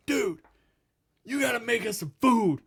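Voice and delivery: put-on high, loud pleading drunk voice; late-night drunk begging the manager for food; drunk buddy. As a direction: beg for food like a tipsy friend. Drunk singer demands food.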